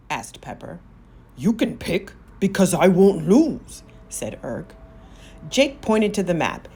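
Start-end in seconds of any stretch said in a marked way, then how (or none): 1.3-3.8 big, self-assured giant voice, louder as he threatens; a smug giant daring them to try; gloating giant. Acted. Giant boasts.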